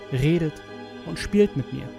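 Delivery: usual speaking voice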